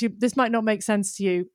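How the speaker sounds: ordinary delivery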